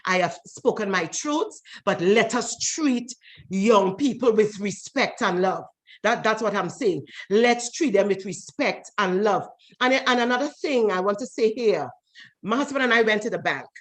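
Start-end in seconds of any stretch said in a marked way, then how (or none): 1.8-9.5 slow, deliberate appeal; slow earnest plea. Real, not acted. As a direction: slowly drive home the point.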